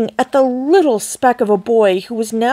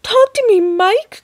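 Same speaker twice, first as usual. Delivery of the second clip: high frantic plea, much higher and louder; frantic cry; 11 semitones higher, 4 dB louder than usual. A narrator's reading. Mother begging her tiny son to speak, frantic high plea. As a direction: beg him to answer, near panic. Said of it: "cried Missus T V".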